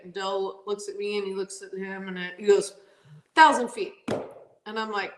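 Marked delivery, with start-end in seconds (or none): 3.3-3.9 loud, emphatic quoted answer; firm announcement. Retold or imitated. Crew chief answering the starting line official, firm and loud. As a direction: call it out louder and higher.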